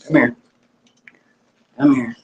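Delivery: normal talking voice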